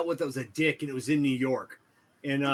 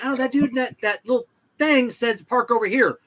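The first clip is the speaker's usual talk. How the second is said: mock protest; 9 semitones higher, 6 dB louder than usual. Retold argument with parking cop.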